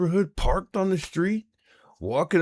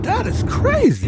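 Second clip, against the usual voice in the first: high, louder cry of disbelief; shocked high exclamation; stunned; 5 dB louder than usual. Real, not acted. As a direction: blurt it out like you just can't take it in. Cuts into cohost's plug, stunned.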